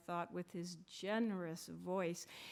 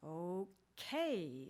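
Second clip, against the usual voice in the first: Okay stretched, stress on the second syllable kay; slow drawn-out okay; 3 semitones lower than usual. Retold or imitated. Teacher answering a student, slow drawn-out okay. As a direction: draw it out and land on kay. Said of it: "slowed down, drawn out, second syllable, stressed".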